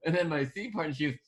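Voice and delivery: normal talking voice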